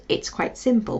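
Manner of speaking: normal talking voice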